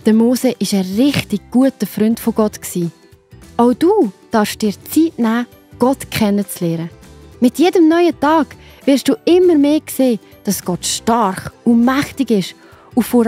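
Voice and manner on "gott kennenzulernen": ordinary talk, nothing marked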